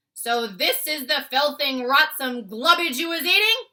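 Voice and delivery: loud disgusted giant boom. Acted. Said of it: "boomed the blood bottler".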